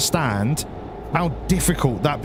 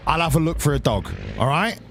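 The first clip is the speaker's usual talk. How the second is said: ordinary talk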